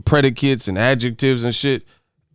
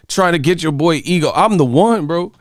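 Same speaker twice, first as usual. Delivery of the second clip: usual speaking voice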